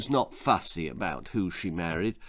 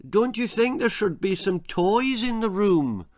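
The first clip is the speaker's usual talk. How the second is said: pitched-up, gentle Betty voice; timid question; 9 semitones higher than usual. A narrator's reading. Wife timidly asks husband about toys, lightly voiced. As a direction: ask shyly, hoping he'll agree. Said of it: "asked Betty".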